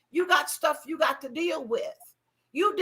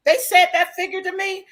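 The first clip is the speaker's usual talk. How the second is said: normal talking voice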